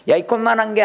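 high-pitched mock belittling; acted scorn. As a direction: taunt someone as puny. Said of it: "하고 멸시하는 거예요". (높은 목소리로 멸시하는 투)

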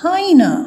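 high, emphatic model of "hyena"; correct pronunciation. Shown as a demonstration. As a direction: stress EE in "hyena". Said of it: "in English we call it".

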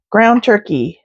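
usual speaking voice

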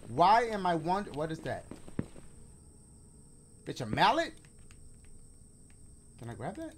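ordinary delivery

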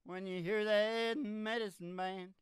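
hammed-up slow sermon call; revival preacher. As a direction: work the crowd like a revival preacher.

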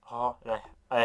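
ordinary delivery

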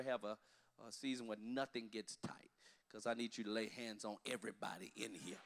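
unvoiced whispered aside. Real, not acted. Pastor to congregation, a whispered aside. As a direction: drop to a whisper for the joke. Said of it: "whispered aside".

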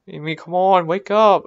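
ordinary talk

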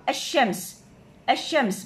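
الشمس said as a-shams, the lam silent and the sheen doubled; sun letter pronunciation. Shown as a demonstration. Teacher showing pupils the silent lam, a-shams. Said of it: "شوف أنا إيش راح ألفظ".

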